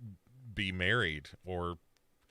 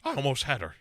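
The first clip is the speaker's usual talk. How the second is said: put-on lightly Irish-accented villain aside; accidental Irish accent; 3 semitones higher, faster than usual. Killer thinking about his victim, with a slight Irish accent. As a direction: slip into a hint of Irish. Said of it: "I don't know why he's Irish too".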